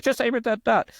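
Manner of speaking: very high, cocky bar guy voice; imitating the bar player. Mocking the bar guy telling him to aim at the dot, very high. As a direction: pitch way up as the know-it-all. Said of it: "he talked like that, of course".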